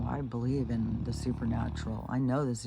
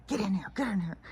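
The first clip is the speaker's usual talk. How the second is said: high, pressing repeated call to the dogs; retold urgent call; 7 semitones higher, faster than usual. Owner calling her dogs back into the house, high and urgent. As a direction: hurry them back inside.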